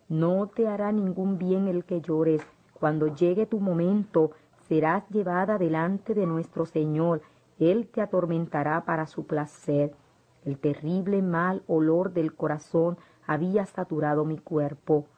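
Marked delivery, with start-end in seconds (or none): none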